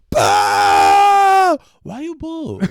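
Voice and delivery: a high, loud mock-accent shout; mock Chinese kid yelling back at the booing crowd.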